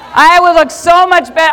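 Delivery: loud, high playful brag; excited boast. Real, not acted. Speaker joking to the audience about the jacket, loud boast.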